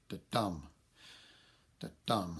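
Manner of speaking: "da-dum" with a light "da" and a firm, stressed "dum"; iambic stress. Shown as a demonstration. Lecturer shows students what an iamb sounds like, "da-dum" stressed on "dum". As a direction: tap out "da-dum" as an iamb, leaning on "dum". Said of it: "The first syllable is not stressed, the second is".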